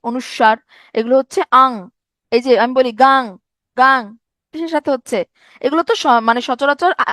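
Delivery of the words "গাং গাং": গাং said with a final ng sound like উয়ো; pronunciation demo. Shown as a demonstration. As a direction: sound out গাং, ending on the nasal ng. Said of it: "কিসের মতো উচ্চারণ? উয়োর মতো". (গাং-এর শেষে উয়োর মতো উচ্চারণ)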